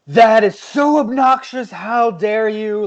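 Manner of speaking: high, slow, drawn-out offended protest; mock outrage. Retelling the woman who flipped out, mock outraged. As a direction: imitate an upset woman, loud and scandalized. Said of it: "she was so upset".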